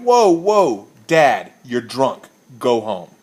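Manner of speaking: loud, slow exasperated shout back; yelling back. Retold or imitated. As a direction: tell a drunk off across the bar. Narrator yells back at drunk.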